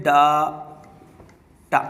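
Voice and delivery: डाटा hugely stretched, daaa, then taa; exaggerated wrong pronunciation. Shown as a demonstration. Teacher dwells on the common mistake, very slow daaa-taa. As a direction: drag out both syllables of डाटा. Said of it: "क्या बोलते हैं?".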